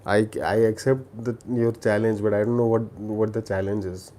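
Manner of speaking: normal talking voice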